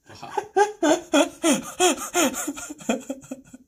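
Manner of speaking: long, helpless high-pitched laughing fit; real sustained cackling laughter; high wheezing laugh. Real, not acted. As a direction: crack up in rapid high bursts. Cracks up at a comment.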